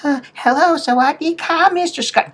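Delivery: high-pitched mock greeting from a billing lady; hospital billing lady. Lady greeting a patient at a bad moment, high mock voice. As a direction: imitate her in a very high voice. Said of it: "She comes in there".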